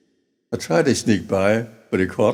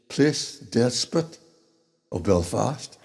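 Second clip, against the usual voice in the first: ordinary delivery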